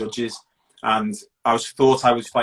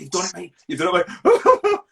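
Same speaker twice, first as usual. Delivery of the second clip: elated coach; 6 semitones higher, 4 dB louder than usual. Retold or imitated. Coach hugging him after winning.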